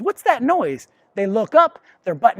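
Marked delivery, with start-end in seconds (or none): 0.0-0.9 high, curious puppy; lightly voiced puzzled puppy. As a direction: play the puppy, puzzled by the sound. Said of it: "The puppy goes".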